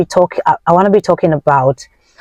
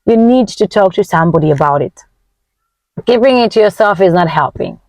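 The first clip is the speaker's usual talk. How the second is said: ordinary talk, nothing marked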